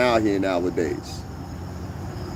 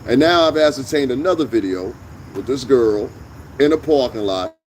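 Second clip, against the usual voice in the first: ordinary talk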